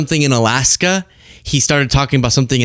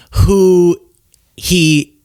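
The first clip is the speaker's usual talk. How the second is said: usual speaking voice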